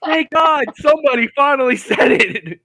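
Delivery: high, comic cry of relief; retold huge relief. Caller glad the rocker finally refused, retold as high comic relief. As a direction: let relief burst out, pitched well up.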